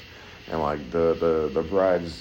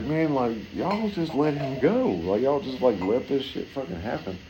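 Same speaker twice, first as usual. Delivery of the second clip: parents scolding; 8 semitones higher than usual. Retold or imitated. Parents screaming at their rescued son, high and angry. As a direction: yell at the kid who nearly drowned. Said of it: "I wanted to be like".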